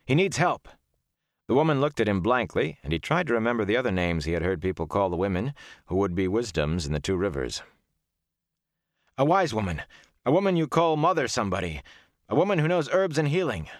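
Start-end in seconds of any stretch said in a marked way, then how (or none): 0.0-0.7 high, urgent insistence; demanding. A narrator's reading. Rand to the crowd for injured Huron, urgent demand. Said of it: "Rand demanded". 9.2-13.7 high-pitched, pressing explanation; urgent explaining. A narrator's reading. Rand searching for words for a healer, urgent.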